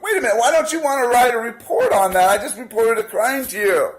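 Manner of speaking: high-pitched incredulous challenge; indignant protest. Retold or imitated. Protester calling after police who refused his report, indignant.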